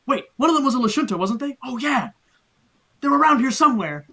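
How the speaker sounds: high, quick puzzled guard voice; a guard working it out aloud; confused guard. Acted.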